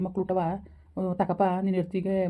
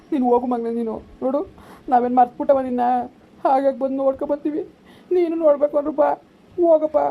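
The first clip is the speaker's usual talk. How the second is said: high, loud, tearful reassurance; tearful urging; 6 semitones higher, 5 dB louder than usual. Acted. Parent urging the child to go and visit later, tearful urging. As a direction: coax the child through tears. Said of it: "ಅಳ್ಬೇಡ ಕಣಪ್ಪ, ಅಳ್ಬೇಡ".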